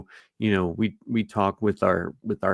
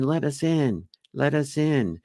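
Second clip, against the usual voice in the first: higher-pitched repeated chant; creepy child repeating one request; creepy kid plea; 6 semitones higher than usual. Acted. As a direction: ask like an eerie child at the door. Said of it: "there's these creepy kids on their front porch".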